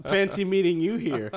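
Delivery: high, loud, through laughter; hammed-up line, cracking up; laughing mock greeting. Co-host giggles through a mock showdown line.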